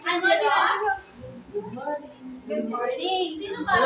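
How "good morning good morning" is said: soft, lower good morning. Shown as a demonstration. Pupil showing teacher a soft voice, quiet. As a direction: say it gently and quietly. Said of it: "using a soft voice".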